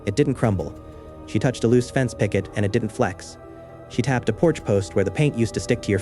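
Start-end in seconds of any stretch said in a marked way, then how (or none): none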